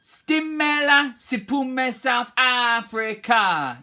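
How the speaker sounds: high, loud lead line; teacher leads the chant; called out. Shown as a demonstration. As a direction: call each word out to the group. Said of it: "Me, then you".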